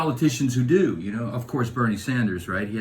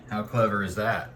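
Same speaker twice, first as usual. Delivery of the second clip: normal talking voice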